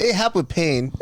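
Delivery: Korean lady doctor. Retold or imitated. Korean doctor recommending relief for pain, mock Korean accent. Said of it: "she talks like that".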